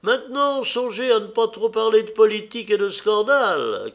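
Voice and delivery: high-pitched call from the door. A narrator's reading. Lady at the door warning the men, calling out. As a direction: call back across the room on your way out. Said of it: "cria Lady Marlborough de la porte".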